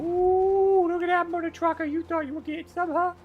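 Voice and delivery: high, loud mocking taunt. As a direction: jeer at the enemy. Taunting enemy in game.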